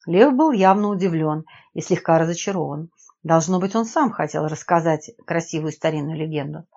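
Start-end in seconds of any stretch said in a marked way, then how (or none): none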